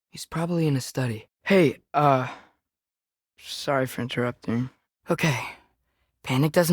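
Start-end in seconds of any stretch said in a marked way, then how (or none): none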